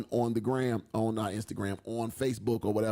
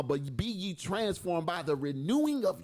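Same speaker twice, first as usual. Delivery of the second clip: very slow, drawn-out sung preaching; lingering King James reading, almost sung; extra scripture reading; 4 semitones higher, much slower than usual. Acted. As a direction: stretch every word like a sermon climax. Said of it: "I know that sounded a little extra".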